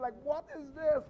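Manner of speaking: high, loud, incredulous question; exasperated disbelief. Retold or imitated. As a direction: exclaim it like you can't believe it. Reacting to repeated travel mishaps.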